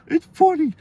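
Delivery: high, loud little-brother squeal; imitated giggling kid. Little brother laughing while in trouble, high protest. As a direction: pipe it up high like a kid who can't behave.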